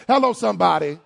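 ordinary delivery

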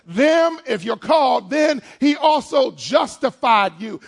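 loud, slow preacher's cry; full-force preaching. Acted. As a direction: preach it out to the congregation. Preaching builds.

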